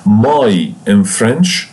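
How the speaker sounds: "My in French" stretched out slowly; slow down to flag the key point; careful, deliberate "My in French"; slow emphatic point. Shown as a demonstration. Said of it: "pay attention to this point".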